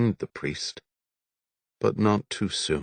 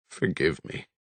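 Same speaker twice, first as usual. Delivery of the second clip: quiet plea; 4 dB quieter than usual. A narrator's reading. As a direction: beg under the breath. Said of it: "McCrithy pleaded".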